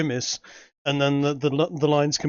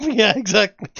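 laughing; 6 semitones higher, 6 dB louder than usual. Real, not acted. Laughing delight at drawing.

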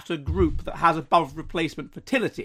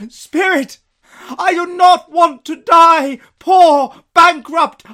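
high, loud, slow anguished shouting; Scrooge's anguished shout; 11 semitones higher, 13 dB louder, much slower than usual. Acted. Scrooge crying to the spirit, anguished shout. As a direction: shout out your fear of dying.

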